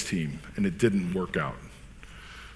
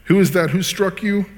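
loud, high taunting demand; mocking; 8 semitones higher, 5 dB louder than usual. A narrator's reading. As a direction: taunt the captive, pitched up and loud. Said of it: "were mocking him as they beat him".

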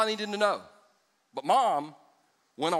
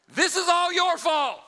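high, loud, slow accusing yell; 13 semitones higher, 6 dB louder, slower than usual. Acted. Sarah yells at Abram, high slow accusation. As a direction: blame him at full volume. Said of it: "so she's yelling".

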